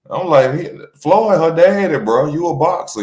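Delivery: ordinary talk, nothing marked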